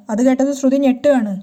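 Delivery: ordinary delivery, nothing marked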